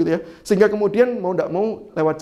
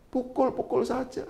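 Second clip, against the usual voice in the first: softer, higher quiet dare; soft taunt; 6 semitones higher, 9 dB quieter than usual. Retold or imitated. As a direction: goad him gently. Istri menantang suami. ("pukul-pukul saja" diucapkan pelan menantang)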